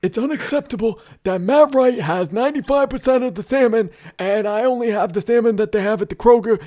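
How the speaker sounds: high, loud whiny Larry David rant; Larry David impression. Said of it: "Excellent Larry David impersonation".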